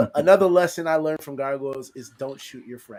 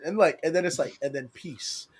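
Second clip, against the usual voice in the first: usual speaking voice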